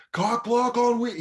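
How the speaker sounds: high, slow mock holler; heckler yelling. Drunk fan shouts comic's punchline, imitated holler. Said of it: "is yelling out the punchlines".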